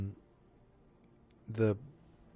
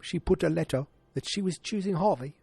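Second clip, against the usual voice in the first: hammed-up British actor, rising in pitch; Michael Caine; 6 semitones higher than usual. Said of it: "Let me do a better Michael Caine".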